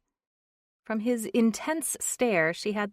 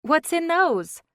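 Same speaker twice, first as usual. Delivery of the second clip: put-on young woman voice; 11 semitones higher, 8 dB louder, slower than usual. A narrator's reading. Brooke, curious.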